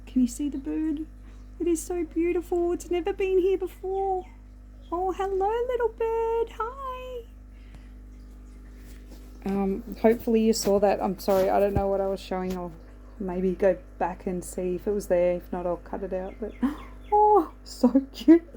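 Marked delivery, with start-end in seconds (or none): 0.0-7.2 cooing at bird. Real, not acted. 16.6-18.4 high, loud, drawn-out squeal of delight. Real, not acted. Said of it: "I'm so excited about that".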